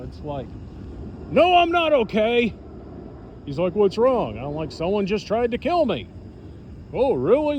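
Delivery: ordinary delivery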